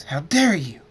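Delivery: outraged. A narrator's reading. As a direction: burst out loudly in outrage. Outraged at being winked at.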